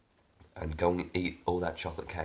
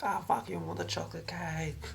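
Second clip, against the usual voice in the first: put-on much higher, squeaky whining child; whiny child tantrum; 12 semitones higher than usual. Inner child demanding chocolate cake, high whiny tantrum. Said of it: "putting up a fucking tantrum".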